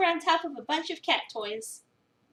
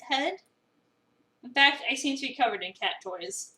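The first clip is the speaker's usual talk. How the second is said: ordinary delivery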